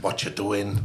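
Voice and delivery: put-on half-hearted go at an Irish accent; badly faked Irish accent. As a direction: attempt Irish and miss. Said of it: "I can't do an Irish accent".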